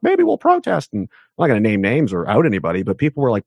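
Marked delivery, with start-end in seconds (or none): 0.0-0.9 much higher, meek mock voice; mock timid suggestion. Mocking a timid protest idea, high and meek. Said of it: "It wasn't just like".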